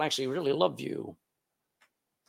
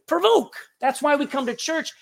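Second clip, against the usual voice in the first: usual speaking voice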